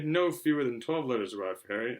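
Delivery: ordinary delivery, nothing marked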